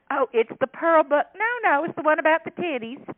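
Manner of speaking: high, loud Southern country voice; sweet country accent. Retold or imitated. Shopper correcting the author, high country twang. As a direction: chirp it out like a cheerful country woman. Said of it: "This real sweet accent country woman".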